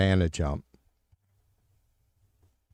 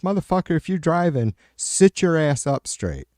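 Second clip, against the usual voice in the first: higher, exasperated dad scolding; a retold fatherly telling-off, pitched up; mock scolding; 8 semitones higher than usual. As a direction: bark it at a slouching kid driver. Scolding son's slouched driving.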